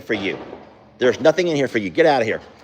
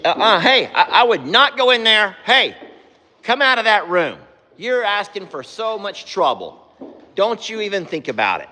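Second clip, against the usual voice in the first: high, sharp scolding of a horse; 10 semitones higher than usual. Real, not acted. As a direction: warn the animal off firmly.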